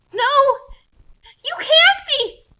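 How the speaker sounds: high, loud horrified cry; horror. Acted. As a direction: scream it in sheer horror. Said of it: "she said in horror".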